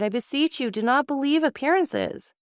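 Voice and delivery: high, begging, frightened voice; desperate pleading. Acted. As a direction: plead for mercy in terror. Wife begging husband for mercy.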